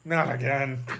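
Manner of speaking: lightly voiced weary groan; a resigned mock moan; John Hurt in Spaceballs. Victim sighs at the chestburster, mild mock weary moan. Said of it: "he just goes".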